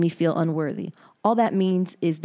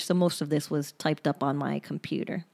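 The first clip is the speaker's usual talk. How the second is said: usual speaking voice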